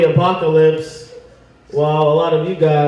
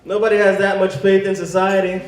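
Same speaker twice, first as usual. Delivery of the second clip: ordinary talk, nothing marked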